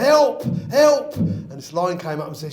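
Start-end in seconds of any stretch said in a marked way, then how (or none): 0.0-1.2 high, loud cry for rescue; shouting for help. Acted. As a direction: yell as if a lion is coming. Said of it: "he started shouting".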